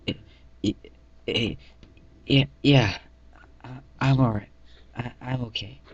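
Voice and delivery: stuttering, scared soldier trying to sound fine; shaky stammer. Acted. Shivering Colt insists to narrator he's okay, stammering. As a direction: stammer out the yeah, then force it steady. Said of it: "shivering, trying to hold his M four".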